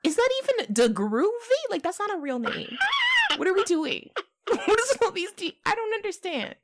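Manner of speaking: high, loud, disbelieving mock outrage; incredulous rant. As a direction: act baffled by all the D names. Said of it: "Sometimes I'd be like".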